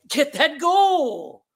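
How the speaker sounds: high, drawn-out coach's call; slow, high rallying cry; coach urging. Acted. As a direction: urge the team on, stretching the words. Coach pushing players to score.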